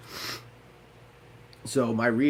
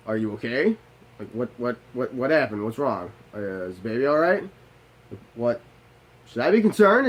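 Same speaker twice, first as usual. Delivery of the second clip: alarmed questions; 5 dB louder than usual. Retold or imitated. Husband fears for his pregnant wife, panicking louder. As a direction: fire off worried questions, building to a shout.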